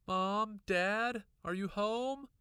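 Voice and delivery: high, loud call through the house; calling out for her parents, rushing in; crying out. A narrator's reading. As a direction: kid shouting for mom and dad on arriving home. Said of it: "I cried".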